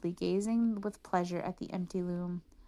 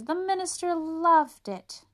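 much higher, louder, slow gush; 9 semitones higher, 6 dB louder, slower than usual. Acted. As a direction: sell it sweetly, drawn out. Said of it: "gushed the swindlers".

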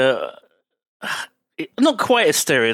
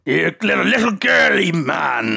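gruff sea captain shout. Retold or imitated. Quoting Quint's insult.

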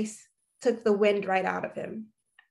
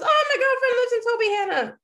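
much higher, louder cry of surprise; surprised cousin; 13 semitones higher, 6 dB louder, much faster than usual. Retold or imitated. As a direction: cousin thrilled by the coincidence.

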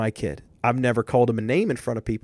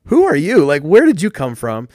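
high, louder stunned disbelief; boy stunned after the pitch works, high and loud; amazed kid; 4 semitones higher, 8 dB louder than usual. Retold or imitated. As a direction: gape at this stranger in wonder. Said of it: "He's looking at me like".

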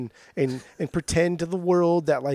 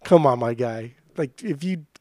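normal talking voice

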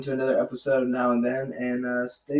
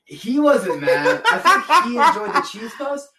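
laughing; 9 semitones higher, 6 dB louder than usual. Real, not acted. Laughing while telling prank.

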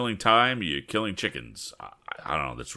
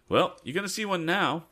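high, confident comeback; retort; 12 semitones higher than usual. Acted. Tom answers the boastful driver, high and sure. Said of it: "the retort Tom permitted himself".

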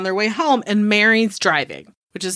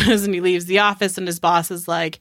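ordinary talk

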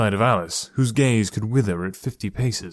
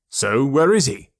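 louder, challenging father; demanding; 4 semitones higher, 5 dB louder than usual. Acted. Father challenges his son to show his friend, demanding and louder. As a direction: demand an answer sharply. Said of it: "his father demanded".

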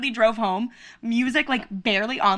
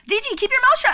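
mock angry; 11 semitones higher than usual. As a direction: snap at her playfully. Telling off Dee Dee.

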